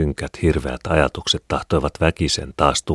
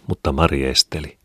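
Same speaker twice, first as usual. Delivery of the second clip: usual speaking voice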